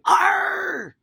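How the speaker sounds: loud, high pirate shout of "Arr"; hammed-up pirate cry. Shown as a demonstration. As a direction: show the kids how a pirate shouts "Arr". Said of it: "You gotta say".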